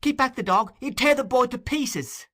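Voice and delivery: high, loud desperate cry. Acted. Nancy crying to Bill to hold back the dog, loud. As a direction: shout to stop him. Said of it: "cried Nancy".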